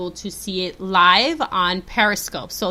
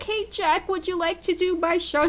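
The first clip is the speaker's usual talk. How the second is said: sweet high asking voice; 8 semitones higher, 5 dB quieter than usual. Retold or imitated. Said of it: "I was like".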